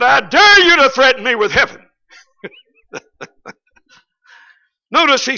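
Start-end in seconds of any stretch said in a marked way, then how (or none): 0.0-1.9 defiant preacher. Retold or imitated. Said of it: "He said".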